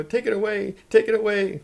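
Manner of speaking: high, loud frantic screaming; screaming Grandma. A narrator's reading. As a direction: scream to have it removed at once. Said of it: "screamed Grandma".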